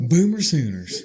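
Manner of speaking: loud laughing chant; laughing cheer. Pretend.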